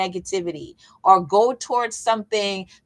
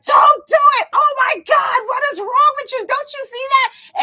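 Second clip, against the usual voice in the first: high, loud acted shriek; frantic high-pitched movie-watcher yell; yelling and screaming; 6 dB louder than usual. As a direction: scream a warning at the screen. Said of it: "we are yelling and screaming at".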